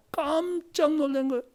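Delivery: normal talking voice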